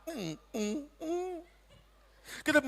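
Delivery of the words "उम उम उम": soft high whine; dog whimper. Retold or imitated. Dog whimpers back softly. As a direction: whimper quietly like a puppy.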